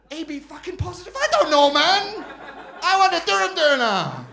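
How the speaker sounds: exasperated outburst. Retold or imitated. Customer snaps at doner guy's questioning, exasperated yell. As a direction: explode in comic frustration.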